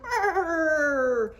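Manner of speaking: high, loud shivering brrr. A narrator's reading. The cold snowman shivers, a loud high brrr.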